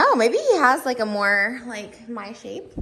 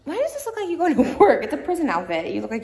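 excited; 6 semitones higher, 13 dB louder than usual. Real, not acted. Loud excited banter.

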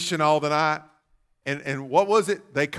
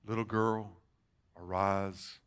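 softer, low, gentle call; tender command; 8 semitones lower, 11 dB quieter, slower than usual. Acted. Jesus to the dead girl, a tender soft command. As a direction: wake her gently.

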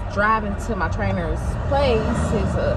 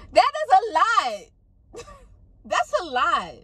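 excited; 10 semitones higher, slower than usual. Real, not acted. Excited about losing weight.

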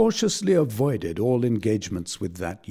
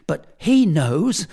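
high, slow, louder, on the edge of tears; tearful; 7 semitones higher, 6 dB louder, slower than usual. A narrator's reading. Blifil breaks down telling the tutor, slow and tearful. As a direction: drag it out as the tears come. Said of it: "Here he burst into a flood of tears".

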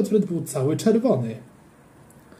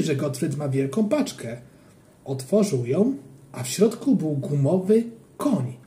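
plain reading voice

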